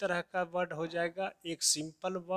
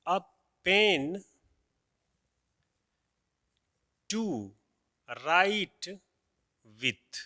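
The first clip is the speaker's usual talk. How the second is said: each word set apart by long pauses; one word at a time, as if writing it on the board; slow word-by-word dictation; dictated word by word; much slower than usual. Shown as a demonstration. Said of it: "कि ए".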